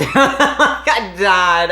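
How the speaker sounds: high, loud, helpless laughing. Real, not acted. Wife recalls the dinner blunder, laughing hard. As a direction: crack up while speaking. Cracking up at her own story.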